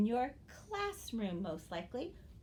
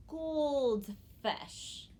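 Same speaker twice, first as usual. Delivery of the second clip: goldfish sung out higher, a bright reveal; proud reveal; 6 semitones higher than usual. Shown as a demonstration. Teacher revealing the new word goldfish to kids. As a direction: present goldfish like a prize. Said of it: "So my new word is".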